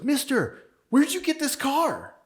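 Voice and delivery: put-on high-pitched, drawn-out kid's question; slow, high imitation of a curious boy; imitating little boy. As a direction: call out like an awestruck little kid. Little boy quizzes the car owner.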